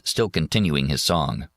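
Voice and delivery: usual speaking voice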